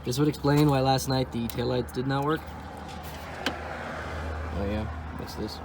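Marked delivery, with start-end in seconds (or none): none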